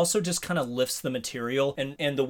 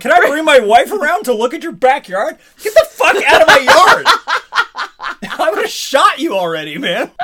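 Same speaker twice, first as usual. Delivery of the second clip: laughing, shouted rant, much higher; mock furious yell; 11 semitones higher, 11 dB louder than usual. Ranting at the movie postman, laughing mock fury. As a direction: yell him off the lawn through laughter. Mocking postman then yelling.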